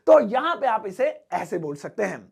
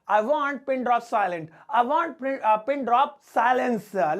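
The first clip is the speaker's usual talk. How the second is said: slow model phrase; much slower than usual. Shown as a demonstration. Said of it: "इसे English में बोलेंगे".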